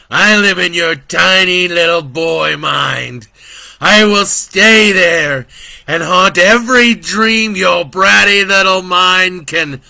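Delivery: slow devil voice rising to a loud roar; menacing devil. Acted. Devil threatens to haunt Brandon, slow and swelling loud. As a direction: threaten the child, building.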